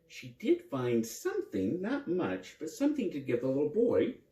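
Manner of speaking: plain reading voice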